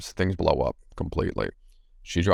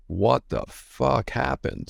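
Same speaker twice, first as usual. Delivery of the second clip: slow, higher mock-stunned question; retold disbelief; 5 semitones higher, slower than usual. Man retelling his reaction to wife's tirade, stunned.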